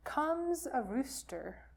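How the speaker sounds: ordinary delivery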